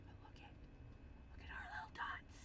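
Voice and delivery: very soft, whispered aside; admiring whisper. Real, not acted. Crafter showing viewers the little dots, whispering. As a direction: whisper in wonder at something tiny.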